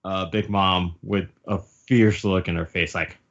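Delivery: usual speaking voice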